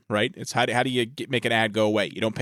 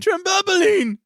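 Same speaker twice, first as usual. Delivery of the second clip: very high, loud shriek; excited squeal; 5 dB louder, much slower than usual. Retold or imitated. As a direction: shriek it with childish joy. Quoting the catchphrase.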